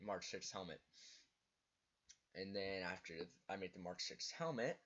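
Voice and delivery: usual speaking voice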